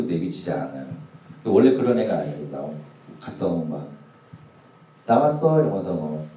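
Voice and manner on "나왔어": bright, high "I'm home" call; a daughter's lively greeting, higher and louder; cheerful daughter greeting. Retold or imitated. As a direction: sing out a happy hello to dad. Daughter greeting her dad.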